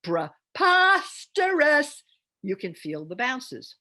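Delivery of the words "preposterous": loud, high, slow call with every syllable bouncing; called like a child's name. Shown as a demonstration. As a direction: shout "Preposterous" like calling a kid home. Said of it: "as if it's a child's name".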